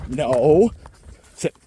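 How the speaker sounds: normal talking voice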